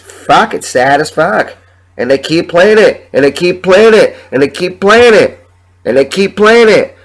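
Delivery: vent, each repeat hammering harder; exasperated outburst. Real, not acted. Ranting to listeners about the radio station, exasperated. Frustrated rant about the clip.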